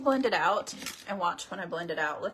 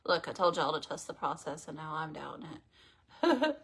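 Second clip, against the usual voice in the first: ordinary talk, nothing marked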